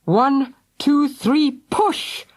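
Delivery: a lightly voiced dad counting effort; urging. A narrator's reading. Mister Thunder urges his wife to push the timber. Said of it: "urged Mister Thunder".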